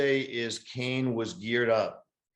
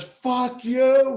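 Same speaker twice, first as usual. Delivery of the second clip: high, loud mock yell; retold angry shout; 12 semitones higher, 9 dB louder than usual. Woman on the beach snaps at dog walker, mock yell. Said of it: "Then she goes".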